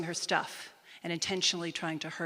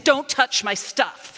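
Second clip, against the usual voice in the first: louder, higher, slow warning; rising anger; 6 semitones higher, 9 dB louder, slower than usual. Retold or imitated. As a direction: say it through building anger. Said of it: "in a rage".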